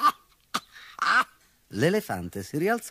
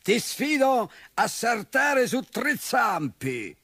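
bull's challenge; 9 semitones higher, slower than usual. A narrator's reading. Bull voice.

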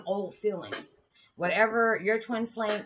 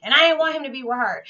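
high, loud, territorial protest; jealous child; 7 semitones higher, 8 dB louder than usual. Retold or imitated. As her young self answering mom about dad's girlfriend, loud and high. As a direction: whine it like a jealous kid. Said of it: "I said".

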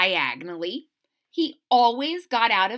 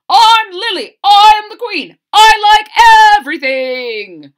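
put-on much higher, very loud queenly little girl; Lily proclaiming herself the queen; bossy Lily; 11 semitones higher, 19 dB louder than usual. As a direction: announce yourself like a queen. Lily introduces herself loudly.

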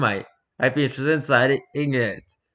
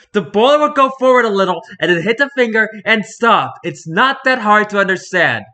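loud, higher, insisting it's obvious; exasperated shout; 7 semitones higher, 7 dB louder than usual. Acted. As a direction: hammer the point like talking to a fool. Acted stubborn commenter shouting back.

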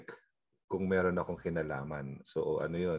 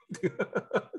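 laugh; 13 semitones higher than usual. Real, not acted. Laughs at own story.

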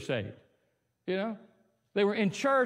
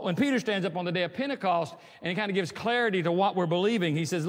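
usual speaking voice

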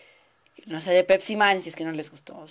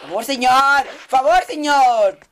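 loud pleading; 6 semitones higher, 14 dB louder, slower than usual. Acted. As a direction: shout begging please sir. Acted begging quote, shouted.

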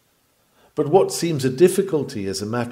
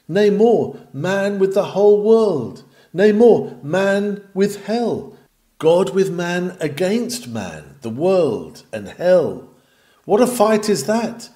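louder, higher, swelling oratory; fiery preaching; 5 semitones higher, 5 dB louder, slower than usual. Acted. Preacher stirs the congregation with rising force. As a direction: build the struggle up to a roar.

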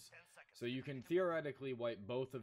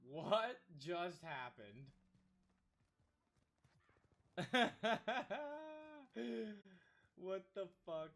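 stunned; 9 semitones higher, slower than usual. Real, not acted. Stunned by the clip.

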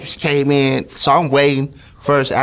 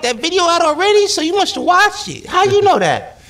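high, fast, incredulous questions; mock astonished questioning; surprised suspicion. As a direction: catch him out, pitched way up. Suspicious question to guard retold.

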